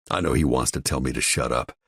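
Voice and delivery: ordinary delivery, nothing marked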